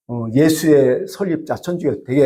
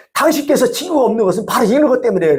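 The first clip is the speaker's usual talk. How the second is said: mock shout; 6 semitones higher than usual. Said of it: "막 소리 확 쳤대요".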